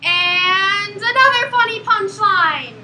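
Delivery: grinning comic sells the punchline, the second stretched slowly; milk each joke; hammed-up punchline delivery. Shown as a demonstration. Performer demonstrates telling punchlines to a stony audience, hammed up. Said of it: "quick demonstration".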